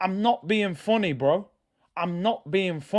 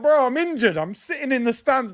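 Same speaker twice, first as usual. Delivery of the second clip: high, laughing put-on voice of a whiny footballer; mocking the player; 8 semitones higher, 4 dB louder than usual. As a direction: squeal it through a laugh, mocking his excuse. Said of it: "man's like".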